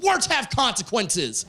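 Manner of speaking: yelled catchphrase. Retold or imitated. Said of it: "I yelled".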